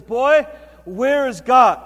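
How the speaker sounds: loud, slow, drawn-out demand; booming pastor. Retold or imitated. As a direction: press the boy hard. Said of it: "this big booming voice".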